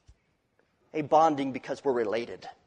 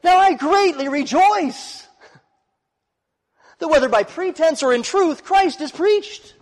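much higher, slow, joyful voice of Paul; a rapturous apostle from prison; Paul rejoicing; 13 semitones higher, 8 dB louder than usual. Retold or imitated. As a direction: let Paul's joy ring out, slowly. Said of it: "and he says".

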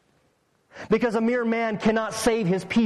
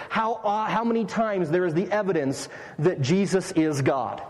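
usual speaking voice

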